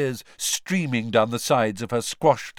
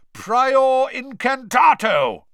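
slow, loud, high roared incantation; roared spell; 10 dB louder, much slower than usual. A narrator's reading. Wizard casting a spell, roaring. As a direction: roar the spell out. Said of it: "roared Mister Diggory".

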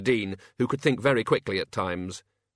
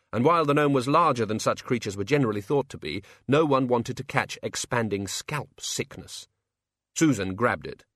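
ordinary delivery

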